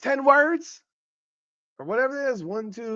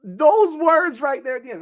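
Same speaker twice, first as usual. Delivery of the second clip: laughing helplessly through the words, pitched up; can't stop cracking up at the quote; 7 semitones higher than usual. Real, not acted. Host cracking up over Clark's closing words, laughing through it. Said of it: "sorry I'm laughing".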